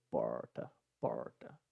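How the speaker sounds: porta with a drawn-out retroflex r, softly; throat-vibrating R. Shown as a demonstration. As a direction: hold the r in porta so the throat buzzes. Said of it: "Você sente sua garganta vibrar aqui".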